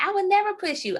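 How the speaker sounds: high-pitched fake innocence; mock innocent protest. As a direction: squeak out the denial. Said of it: "And I was like".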